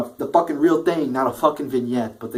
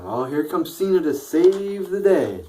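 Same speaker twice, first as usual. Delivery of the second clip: higher, slow, mocking hype; sarcastic mock announcement; 6 semitones higher than usual. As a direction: sneer it like a fake hero fanfare. Mocking fans' hero entrance.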